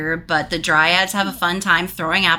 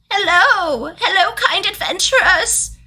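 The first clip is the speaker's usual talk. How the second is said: eager servant voice; 12 semitones higher than usual. Acted.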